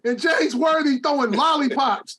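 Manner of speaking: fired-up shout. Real, not acted. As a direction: yell it out, genuinely worked up. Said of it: "you got me fired up".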